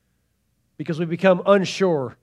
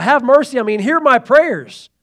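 higher, louder plea for mercy; crying out; 6 semitones higher, 7 dB louder than usual. Retold or imitated. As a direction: cry out to God for mercy. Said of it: "King David's crying out".

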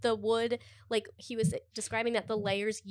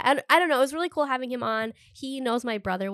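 ordinary talk

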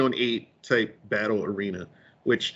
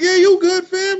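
high and loud, hyped up; hyped reply; 6 dB louder than usual. Retold or imitated. Friend reassuring the speaker, hyped and loud. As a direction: answer all hyped up.

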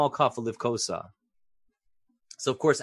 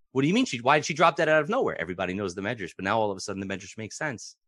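ordinary delivery

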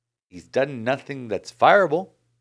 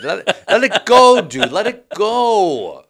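exasperated plea; 8 semitones higher, 9 dB louder, slower than usual. Real, not acted. Friend nagging about Mattingly.

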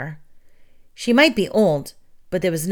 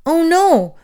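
high, louder cry of dismay; despairing cry; 10 semitones higher, 6 dB louder than usual. A narrator's reading. Winda realizing she'll miss the ceremony, panicked cry. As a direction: blurt it out in sudden panic. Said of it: "wrung her hands in despair".

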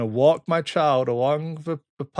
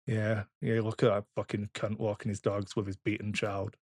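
muttering onlooker; 4 semitones lower, 7 dB quieter than usual. Retold or imitated. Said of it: "looks from people".

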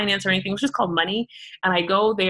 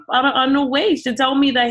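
normal talking voice

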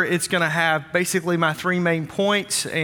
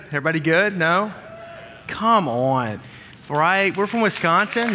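usual speaking voice

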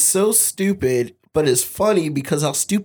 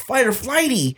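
higher, put-on voice for the catchphrase; mock boxer catchphrase; 7 semitones higher than usual. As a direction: punch out fight or flighty in a silly voice. Tyson catchphrase imitated.

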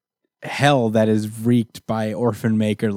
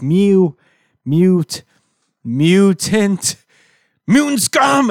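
higher, louder mew turning into mutant scum; building baby voice; 8 semitones higher, 4 dB louder, much slower than usual. Retold or imitated. Cyborg baby turning on Nanny, rising high and loud. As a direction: build from mew up to a sneering snarl.

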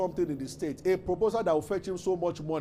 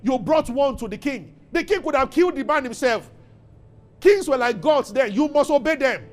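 high, loud, emphatic preacher; full-force preaching; 7 semitones higher, 7 dB louder than usual. Real, not acted. Preacher telling a congregation about the king's order, forceful. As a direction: drive the story home at a raised pitch and volume.

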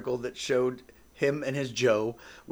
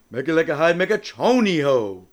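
genie voice; 3 semitones higher, 8 dB louder than usual. Shown as a demonstration. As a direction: intone the wish like a grand genie. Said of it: "I have to do my genie voice".

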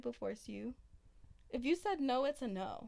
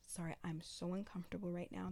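whispering; 2 semitones lower, 7 dB quieter than usual. Shown as a demonstration. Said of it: "you whisper in their ear".

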